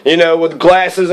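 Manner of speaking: ordinary talk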